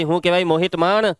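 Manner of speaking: usual speaking voice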